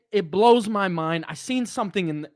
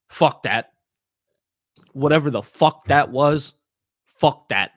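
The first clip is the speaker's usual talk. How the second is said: loud, emphatic curse; louder, furious dismissal; angry outburst; 5 semitones lower, 5 dB louder than usual. Real, not acted. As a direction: spit it out in real frustration. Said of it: "raw, real emotion".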